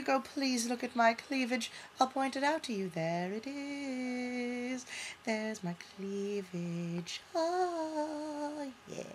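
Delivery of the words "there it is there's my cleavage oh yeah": hammed-up slow seductive murmur; mock seductive. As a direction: purr it out slowly.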